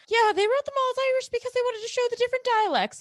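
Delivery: high, fast, mocking know-it-all voice; a sketchy internet explainer, sent up high and fast; mocking quote. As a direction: rattle it off in a silly high voice. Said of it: "sketchy internet explanation that was like".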